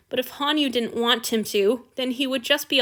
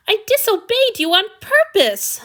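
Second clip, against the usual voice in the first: high, loud protest; 11 semitones higher, 7 dB louder than usual. A narrator's reading. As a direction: protest insistently. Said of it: "Hanyu protested".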